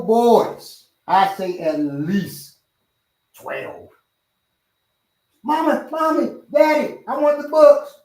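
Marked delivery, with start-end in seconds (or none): 5.4-7.9 put-on high, eager kid voice; excited little-kid plea; pleading child. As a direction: imitate a child begging parents, pitched way up. Child begging parents in story.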